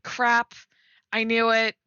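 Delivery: ordinary delivery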